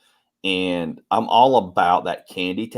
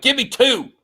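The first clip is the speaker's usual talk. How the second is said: high laughing plea; mock pleading; 8 semitones higher than usual. Father begging his kids for two candies, high and laughing. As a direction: beg like a kid, laughing.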